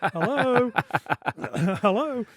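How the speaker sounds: high, laughing saucy "hello"; a suggestive wink of a hello; a hint of a naughty hello; cheeky hello. Mock cheeky greeting for Rio.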